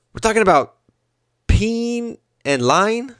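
a light Iverson, stretching "lying" up into a question; Allen Iverson impression. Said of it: "my impersonation of Allen Iverson".

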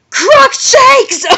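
very high, loud screech; shouted. A narrator's reading. Heroine hurling a spell at Voldemort, high shout. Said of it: "so she just shouted Crookshanks".

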